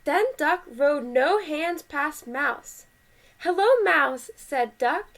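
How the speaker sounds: lively, measured picture-book reading; animated read-aloud with well-marked phrases; story read to children. A narrator's reading. Parent reading Duck on a Bike to kids, animated story voice.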